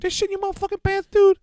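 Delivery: high squeaky mock voice; mocking impression. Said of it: "He just went".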